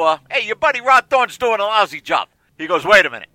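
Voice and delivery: ordinary talk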